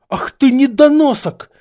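high, very loud outburst; furious shout. A narrator's reading. Snape lunging at the boy, yelling furiously. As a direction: explode with rage at the insult. Snape lunges at Harry, enraged.

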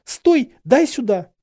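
high, loud shout to stop; urgent command. A narrator's reading. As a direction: shout it urgently to halt him. Hermione urgently stops Harry.

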